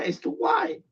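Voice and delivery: jumps up high on "It's too wide."; exasperated. Real, not acted. Vlogger griping to viewers about the radio, high and fed up. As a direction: toss it off high and quick, fed up.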